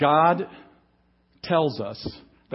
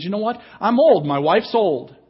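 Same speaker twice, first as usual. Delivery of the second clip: higher and louder on I'm old, a mock complaint; self-pitying protest; 5 semitones higher, 7 dB louder than usual. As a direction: play the old priest whining about his age. Voicing Zechariah's self-focused complaint.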